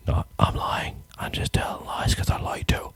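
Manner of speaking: soft breathy aside whispered to the mic. Acted. Admits to viewers the song credit was a lie, whispering. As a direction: let it slip out as a sneaky confession.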